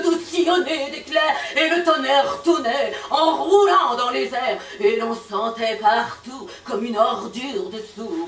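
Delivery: sweeping dramatic declamation; grand theatrical verse; poem recitation. Acted. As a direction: build the storm like an epic.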